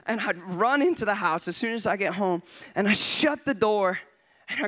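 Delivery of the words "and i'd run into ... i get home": ordinary talk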